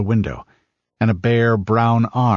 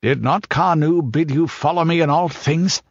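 higher, impatient demand; the Pict irritably reminding the king of his orders; irritable; 7 semitones higher than usual. Acted. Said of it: "asked the Pict irritably".

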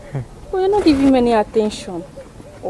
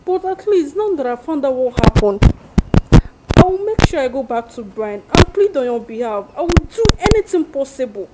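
loud, desperate begging; pleading apology; 3 semitones higher, 7 dB louder than usual. Acted. Friend loudly begging forgiveness after bad advice. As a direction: plead hard for forgiveness, voice raised.

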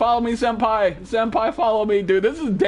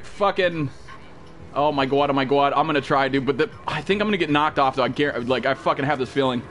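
ordinary talk, nothing marked

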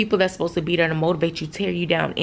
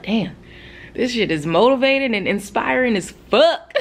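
laughing; 5 semitones higher, 3 dB louder than usual. Retold or imitated. Retold excited thought, laugh heard.

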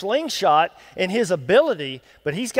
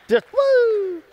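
high laughing whoop; gleeful woo; 13 semitones higher than usual. Pretend. God flicking Goliath off his finger, a laughing high whoop. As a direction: whoop it out as if flicking something away.

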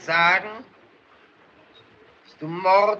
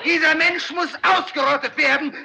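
furious demagogue shout; 6 semitones higher than usual. Acted. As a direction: whip the crowd into a verdict. Mob leader demands execution.

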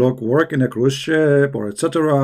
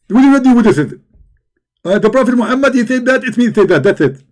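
higher, louder excited preacher impression; Zakir Naik; 6 semitones higher, 3 dB louder, faster than usual. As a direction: lecture like a fired-up debating preacher. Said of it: "If we ask Zakir Naik".

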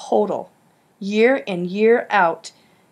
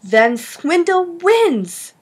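much higher, slow, louder cry; rising outburst; 10 semitones higher, 4 dB louder, much slower than usual. Acted. Griffin losing his temper at Ben in class, high loud outburst. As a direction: let it burst out, loud and high. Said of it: "Griffin's voice rose in tone and volume".

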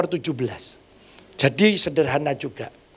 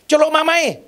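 calling out; 9 semitones higher than usual. Retold or imitated. Nurse calls out.

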